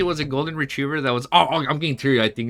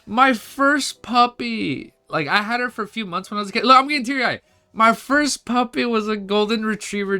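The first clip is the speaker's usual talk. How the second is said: high, gushing, near-crying wail; teary-eyed; 11 semitones higher, 4 dB louder than usual. Real, not acted. Streamer remembers a first puppy, teary-eyed. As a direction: wail fondly as if about to cry. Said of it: "wait, I'm gonna cry".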